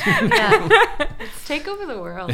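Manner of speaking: mock grand ambition, laughing. Real, not acted. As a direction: joke like a cartoon villain, giggling.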